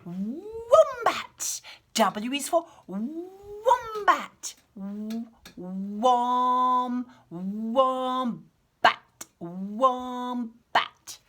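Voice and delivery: W is for wombat said very slowly, Wombat sounded out and repeated; slow careful wombat. Shown as a demonstration. Teacher sounding out wombat for kids. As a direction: break Wombat into wom- and bat. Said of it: "I thought I'd help you make words".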